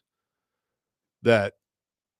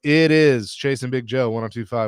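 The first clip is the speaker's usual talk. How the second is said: station plug read; 3 semitones higher, 4 dB louder than usual. Acted.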